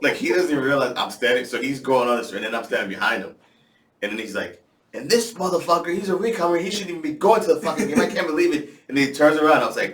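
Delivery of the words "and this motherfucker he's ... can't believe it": mock rant. S1 imitating S0's rant.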